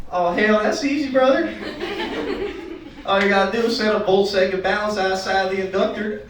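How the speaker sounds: put-on high-pitched Southern accent, laughing through it. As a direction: do a good ol' boy with a thick Southern accent. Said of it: "get caught off guard by the Southern accents".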